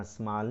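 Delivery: ਸੰਭਾਲ squeezed together as smaal; ਸੰਭਾਲ said softly as smaal; wrong reading. Shown as a demonstration. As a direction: show ਸੰਭਾਲ with its first vowel dropped. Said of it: "ਸੰਭਾਲ ਨਹੀਂ ਪੜ੍ਹਨਾ".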